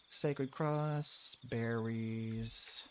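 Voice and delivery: ordinary delivery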